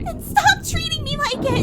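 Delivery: very high and loud; furious shout. Acted. Aurora shouting back at Louis, furious. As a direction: hurl it back at him.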